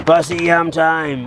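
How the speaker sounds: very slow, drawn-out announcement; slow game-show call to the dogs; announcing feeding time. Real, not acted. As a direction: stretch every word like a big reveal.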